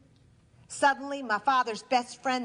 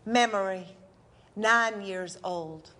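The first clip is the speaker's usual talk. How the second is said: slow, measured title read; stage heading, slow and even; announcer heading; 4 semitones lower, slower than usual. Acted. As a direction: announce the memory slowly. Memory heading.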